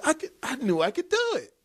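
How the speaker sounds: put-on high, quick child voice; proud little girl. Dad imitating his daughter's proud reply, high and quick.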